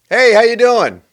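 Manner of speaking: high, loud breezy hello; exaggerated fake-friendly holler; mock hearty greeting. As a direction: call it out cheerily as if walking past. Mimics hollow greeting in passing.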